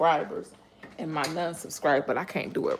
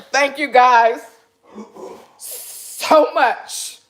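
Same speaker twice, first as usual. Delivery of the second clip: overwhelmed thanks; 6 semitones higher, 9 dB louder, much slower than usual. Real, not acted. Overwhelmed by hitting a thousand subscribers.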